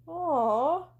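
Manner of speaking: drawn-out cooing aww; teasing sympathy. Acted. Caller teasing Connie for being lonely, cooing. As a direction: coo at the lonely one.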